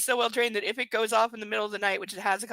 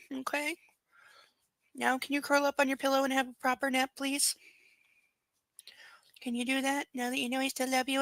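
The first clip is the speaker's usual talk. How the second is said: higher cooing voice for a dog; pet talk; 4 semitones higher than usual. Real, not acted. Owner settling the dog for a nap, cooing. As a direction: coax a sleepy pet to settle.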